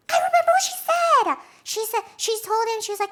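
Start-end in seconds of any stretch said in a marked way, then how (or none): 0.1-1.4 high, loud burst of remembering; real excited exclamation; sudden excited recall. Real, not acted. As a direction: blurt it out as it comes back. Sudden recollection interrupts retelling.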